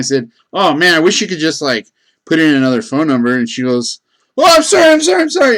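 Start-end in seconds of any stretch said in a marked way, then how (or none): 0.5-3.4 jerk-sounding complaint. Retold or imitated. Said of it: "the biggest jerk sounding". 4.4-5.6 much higher, louder rushed sorry; woman at the pump apologizing in a fluster; flustered apology. Retold or imitated. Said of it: "all apologetic".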